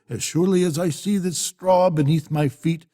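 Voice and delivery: normal talking voice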